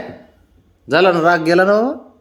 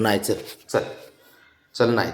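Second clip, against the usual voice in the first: ordinary talk, nothing marked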